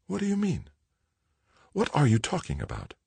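soft, high, bewildered questions; quiet shock, voice lifted; alarmed. A narrator's reading. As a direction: ask in hushed dread. Mother alarmed by son's confession.

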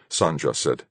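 usual speaking voice

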